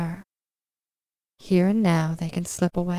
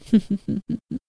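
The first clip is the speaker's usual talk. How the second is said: light giggle; 3 semitones higher than usual. Real, not acted. Playful wake-up laugh.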